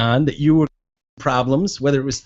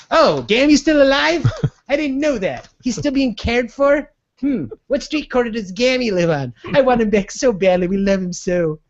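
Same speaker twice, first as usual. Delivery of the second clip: mock father voice; 9 semitones higher than usual. Mocking the father again.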